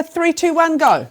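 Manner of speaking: teacher cueing the class to read together; bright countdown. Acted. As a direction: build energy for the class. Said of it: "countdown to read together".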